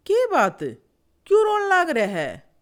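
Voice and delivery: high, loud, insistent questioning; loud alarmed question. Acted. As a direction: call out, demanding to know what's wrong. केकड़ा रोते बगुले से पूछता है.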